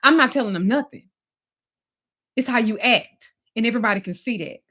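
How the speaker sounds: imitating a partner's heated denial; retold angry denial. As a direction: push the blame back hard. Retold quarrel with Damon.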